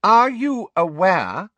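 high, slow, loud official; imperious high-voiced interruption. A narrator's reading. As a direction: cut in with pointed, spaced-out words. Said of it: "Umbridge said loudly".

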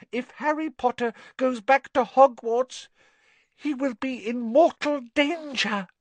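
high, anxious house-elf voice; a fretful little elf pleading. Acted. Dobby's elf voice.

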